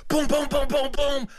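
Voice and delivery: rapid string of high booms; frantic imitated knocking. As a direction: hammer on the door with your voice. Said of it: "Knock more".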